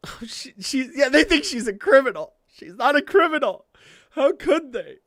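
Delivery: high, loud indignant protest; mock outrage. Real, not acted. Fan protesting to viewers that she's no criminal, indignant. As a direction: defend her like a wronged friend.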